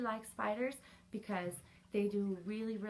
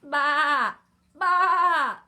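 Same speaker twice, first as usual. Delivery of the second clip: loud, high imitated baa; sheep bleat; 6 semitones higher, 15 dB louder than usual. A narrator's reading. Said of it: "bleated the sheep".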